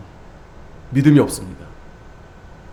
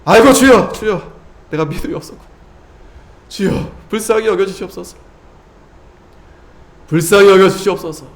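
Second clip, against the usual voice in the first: pleading prayer; 2 semitones higher than usual. Acted. Voiced prayer of repentance.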